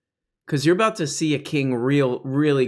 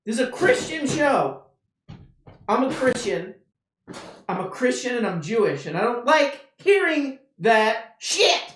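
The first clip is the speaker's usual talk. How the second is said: high falsetto, a prim churchy complaint; mock pious outrage; 9 semitones higher than usual. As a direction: whine it like a scandalised churchgoer.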